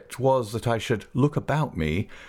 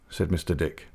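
usual speaking voice

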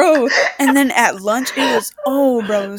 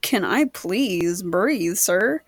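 very slow, lower, drawn-out plea; mock exasperated plea; 4 semitones lower, 4 dB quieter, much slower than usual. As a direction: drag it out, fed up. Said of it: "And I was just like".

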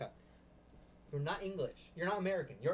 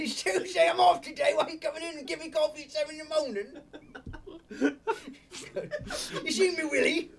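laughing mock British; 8 semitones higher than usual. Playful mock British roleplay, laughing.

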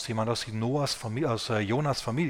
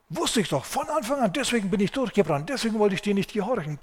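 shrill nagging rant; 8 semitones higher than usual. A narrator's reading. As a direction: whine and scold at God like a sulking prophet. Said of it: "Und Jona keift und zetert".

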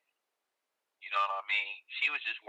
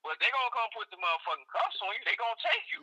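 tipsy bar argument; 11 semitones higher, faster than usual. Real, not acted. Heated argument, voice climbing high.

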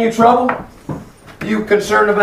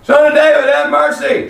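high, raised pleading call; yelling for mercy; 8 semitones higher than usual. Acted. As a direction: call out over the crowd for mercy. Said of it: "I yelled".

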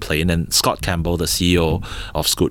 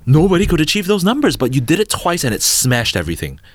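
retold gush, much higher and louder; excited CMO; 8 semitones higher, 3 dB louder than usual. CMO praising the founders' viral posts, excited high gush. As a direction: gush about the record numbers. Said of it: "And then she was like".

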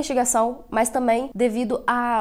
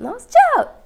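tchau muito agudo e alto, voz rachando; grito de tchau com a voz quebrando; voz falhando; 8 dB louder, slower than usual. Real, not acted. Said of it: "E eu tô super gripada mesmo". (tchau esganiçado de voz gripada)

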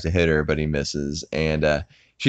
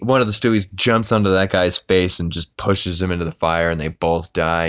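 ordinary talk, nothing marked

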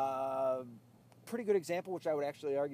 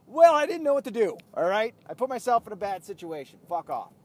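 shouting; 7 semitones higher, 14 dB louder than usual. Real, not acted. Calling out to angry driver.

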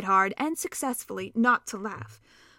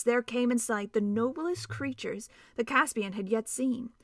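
plain reading voice

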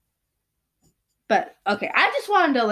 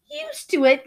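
exclaimed; 8 semitones higher than usual. Acted. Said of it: "Calvin exclaimed".

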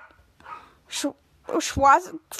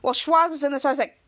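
ordinary talk, nothing marked

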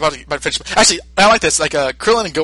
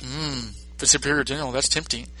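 musing Vegeta; 8 dB quieter than usual. Retold or imitated. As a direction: mull the offer over quietly. Vegeta musing over the offer.

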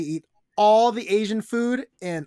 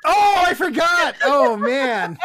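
laughing; 10 semitones higher, 7 dB louder, much slower than usual. Real, not acted. Caught out, laughing at herself.

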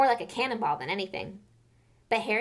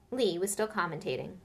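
plain reading voice

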